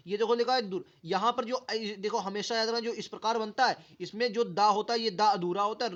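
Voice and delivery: usual speaking voice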